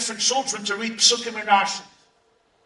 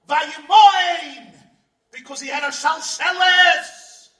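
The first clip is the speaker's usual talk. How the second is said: preaching at full force; 10 semitones higher than usual. Acted. Rabbi proclaims to the congregation, full preaching force. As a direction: proclaim it to the whole hall.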